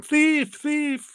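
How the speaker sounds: very high mock alarm cry; high-pitched siren-like warning, played up; alarm going off. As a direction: wail it like a burglar alarm. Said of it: "alarms are going off, going".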